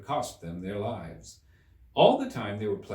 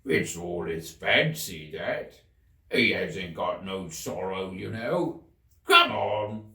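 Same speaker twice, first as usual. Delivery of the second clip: griffin; 3 semitones lower, 3 dB louder, slower than usual. A narrator's reading. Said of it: "very nearly in the same words as before".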